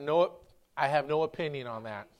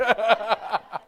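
hearty cackle; loud rattling laugh; laughter; 4 semitones higher, 5 dB louder than usual. Real, not acted. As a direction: laugh it out freely. Laughing after phone joke.

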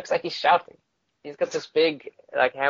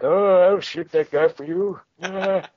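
put-on higher, slow old-timer drawl; put-on old man voice, higher and slower; old man soldier voice; 6 semitones higher, 4 dB louder than usual. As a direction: play the kindly old sniper. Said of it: "It's like".

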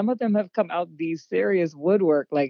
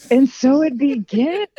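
dramatic movie line; 6 semitones higher, 6 dB louder, slower than usual. Pretend. Dramatic echo of host.

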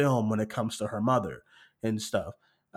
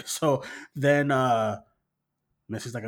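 ordinary talk, nothing marked

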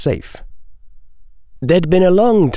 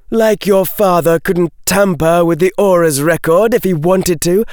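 high, heated sarcastic retort; angry scorn; 10 semitones higher than usual. A narrator's reading. Daphne rejecting Malfoy's defence at wandpoint, angry scorn. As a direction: throw it back at him high and scornful.

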